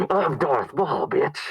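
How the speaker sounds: lightly put-on, cheeky villain announcing himself; mock demon boast. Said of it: "He's like".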